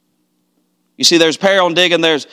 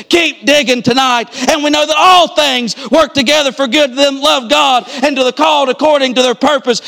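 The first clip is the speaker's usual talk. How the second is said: preaching at full force; 8 semitones higher than usual. Acted. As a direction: drive each line home to the congregation.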